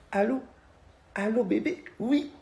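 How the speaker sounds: high, soft cutesy phone voice; sweet little voice. Retold or imitated. Girl answering the phone in a soft, sweet little voice. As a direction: coo it sweetly and small. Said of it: "elle a une petite voix douce".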